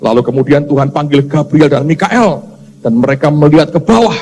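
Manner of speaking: normal talking voice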